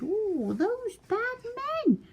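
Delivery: very high, slow mewing cat; meowing cat voice. A narrator's reading. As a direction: stretch it out like a fretful cat. Cats meowing the words.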